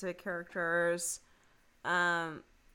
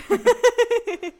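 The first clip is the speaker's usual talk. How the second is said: much higher, louder, slow laughing "Sodomie" in mock French; laughing jingle echo; 14 dB louder, much slower than usual. Host echoes the joke jingle, cracking up in mock French. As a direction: squeal the word through a laugh.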